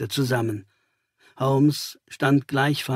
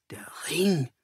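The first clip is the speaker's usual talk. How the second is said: awed whisper of the words; breathed; 2 semitones higher, 8 dB quieter than usual. Acted. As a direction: breathe it out in awe. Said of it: "hauchte ich". (gehaucht, staunend flüsternd)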